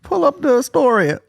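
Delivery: high, drawn-out imitation of her; mock eager demand. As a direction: stretch it out in a high voice. Mimics the woman eager for content.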